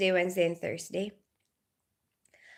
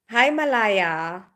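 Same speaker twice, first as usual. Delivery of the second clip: usual speaking voice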